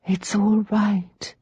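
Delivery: slow, gentle soothing; calm her sister slowly; soothing murmur. Acted. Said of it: "Rachel murmured over and over".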